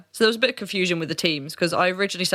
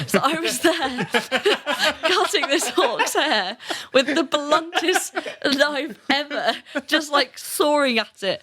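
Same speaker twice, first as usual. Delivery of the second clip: talking through giggles, higher and slower; real laughter breaking into the words; laughing; 8 semitones higher, slower than usual. Real, not acted. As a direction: giggle your way through the story. Laughing at cutting orc hair.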